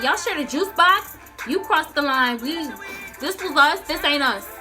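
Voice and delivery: ordinary talk, nothing marked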